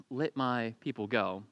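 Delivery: quiet, slow plea; a hushed, slowed-down plea; soft pleading. Acted. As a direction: beg softly to be let go.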